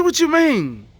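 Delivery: high, startled what you mean; baffled question. Retold or imitated. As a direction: jump up in pitch, puzzled. Confused by advice to do nothing.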